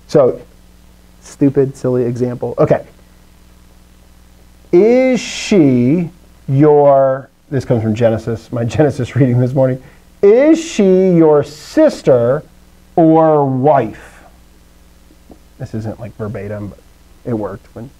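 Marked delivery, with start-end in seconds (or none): 4.7-7.3 very slow, word by word; drawn-out, deliberate quote; slow example reading. Shown as a demonstration. Reads out example sentence slowly. 10.2-14.2 very slow, deliberate question; measured, word-by-word quote; slow example reading. Shown as a demonstration. Reads out example sentence slowly.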